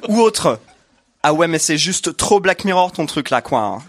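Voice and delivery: lightly put-on Parisian accent. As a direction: drawl it like a Parisian. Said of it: "Ça, c'était l'accent parisien".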